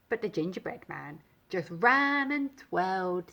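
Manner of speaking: plain reading voice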